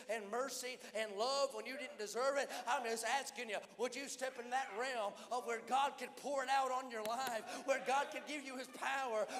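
fervent revival preaching, pressing the crowd; preaching at full force. Real, not acted. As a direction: preach it hard and build the call. Altar call rising to a climax.

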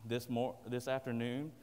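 ordinary talk, nothing marked

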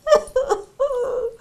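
put-on a little girl's high whimpering sob; high childish sobbing. Lara sobs over her dark lantern, high and childish.

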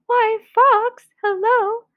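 high, loud cheery greeting; mouse. Acted. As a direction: sing out hello as a little mouse.

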